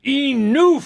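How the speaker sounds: high, loud shouted Enough!; hammed-up movie shout; mock yell. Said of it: "as often as we yell to each other".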